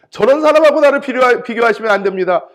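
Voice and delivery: loud, high-pitched indignant complaint; heated protest. Retold or imitated. Pastor protesting to God about a colleague, heated and loud. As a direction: argue back hotly, rushing toward the end. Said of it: "막 열이 받아가지고 막 하나님 앞에서 막 항변을 했어요".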